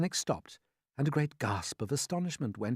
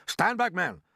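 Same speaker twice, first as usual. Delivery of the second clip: loud, urgent order in a raised voice; yelled warning; 7 semitones higher, 5 dB louder than usual. A narrator's reading. Police chief warning his men of danger, yelling. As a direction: warn your men back urgently. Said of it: "yelled the chief of police".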